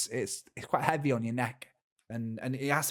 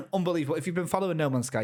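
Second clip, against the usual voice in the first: normal talking voice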